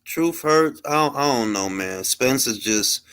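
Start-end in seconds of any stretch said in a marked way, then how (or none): none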